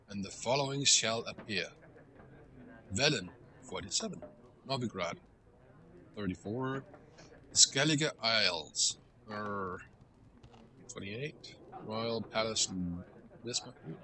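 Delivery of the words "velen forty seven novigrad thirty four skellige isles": ordinary talk, nothing marked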